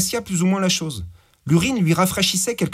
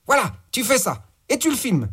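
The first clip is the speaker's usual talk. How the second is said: higher, brash gangster boss; 9 semitones higher than usual. Acted. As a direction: bark orders like a crude crime boss. Boss gives orders by phone.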